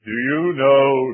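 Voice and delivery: loud, slow, booming question; old evangelist's preaching voice. Retold or imitated. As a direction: imitate an old preacher projecting his words slowly. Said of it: "I could just imagine him preaching the gospel".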